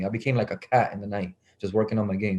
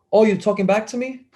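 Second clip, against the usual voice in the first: high-pitched, challenging retort; imitated affronted coach; 9 semitones higher, 3 dB louder than usual. Coach answering kid's backtalk, high affronted challenge. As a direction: throw it back in mock affront, pitch jumping up.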